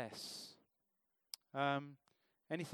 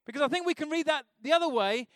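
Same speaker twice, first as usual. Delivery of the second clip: full-force preaching; 7 semitones higher, 9 dB louder than usual. Acted. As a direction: drive the warning home from the pulpit.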